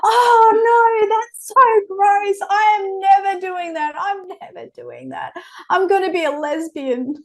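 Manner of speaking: very high, hammed-up child voice, laughing; grossed-out little girl. Mum voicing her eight-year-old, high grossed-out child. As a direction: squeal like a disgusted kid. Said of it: "And she's like".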